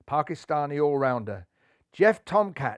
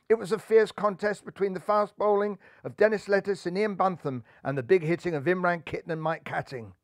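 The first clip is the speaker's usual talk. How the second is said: ordinary delivery, nothing marked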